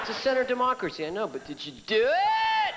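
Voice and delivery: mock-pestering friend. Friends voiced pushing.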